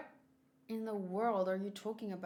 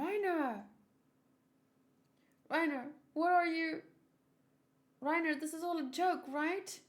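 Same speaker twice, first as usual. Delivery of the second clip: higher, pleading, disbelieving voice; desperate young soldier; 9 semitones higher, 5 dB louder than usual. Acted. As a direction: beg your friend to say it's a joke.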